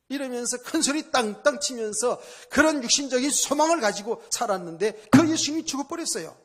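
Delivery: normal talking voice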